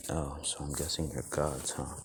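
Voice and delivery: low, soft, resigned-sounding quote; understated, hushed delivery; subdued character voice. Acted. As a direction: say it quietly, dropped below your storytelling voice. Retold character speaks subdued to gods.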